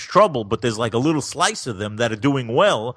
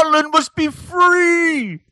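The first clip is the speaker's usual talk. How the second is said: high, loud battle cry, drawn out; hammed-up rebel roar with a long-held last word; William Wallace; 14 semitones higher, 5 dB louder, much slower than usual. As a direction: shout it like a rallying film hero. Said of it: "William Wallace movie was about with Mel Gibson".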